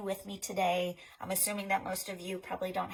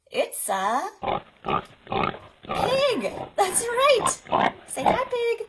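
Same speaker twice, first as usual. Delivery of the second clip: excited reveal; 13 semitones higher, 7 dB louder, much slower than usual. Acted. Revealing the pig to kids.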